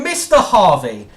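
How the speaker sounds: loud call with a laugh in it; half-laughing furious address; scornful shout of his name through a laugh; angry laughing call. Real, not acted. Furious rant at Brian.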